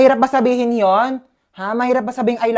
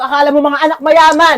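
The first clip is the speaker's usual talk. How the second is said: loud, raised-pitch telling-off; angry scolding; 5 semitones higher, 6 dB louder than usual. Acted. Parent rousing lazy kids in the morning, angry scolding. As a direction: scold them out of bed, loudly.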